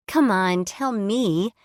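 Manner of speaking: "tell me" with "me" stretched long and whiny; drawn out "me". Acted. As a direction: drag out "me" while begging. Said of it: "She drew out the last word".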